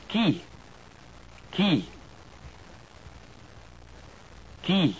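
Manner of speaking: "Qui" said as kee, pure i, no glide; French pronunciation. Shown as a demonstration. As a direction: say "Qui" crisply, French style. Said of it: "followed by the French word twice".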